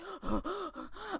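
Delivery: put-on sharp high gasp of a panicked boy; breathless gasp. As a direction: gasp like you just ran up. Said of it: "young lad runs up".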